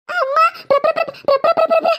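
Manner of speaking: high stuttering pra pra pra; too scared to get the word out; girl stammering to tell mother about the ghost; terrified stammer. Acted. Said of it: "എന്താണ് നീ തിട്ടയ്യ പാടുന്നത്".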